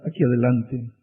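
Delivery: ordinary talk, nothing marked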